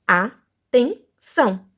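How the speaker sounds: ATENÇÃO split slowly into a, ten, ção; syllable by syllable. Shown as a demonstration. Teacher showing the three syllables, said separately. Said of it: "Três sílabas".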